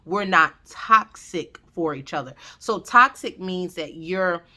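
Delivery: ordinary delivery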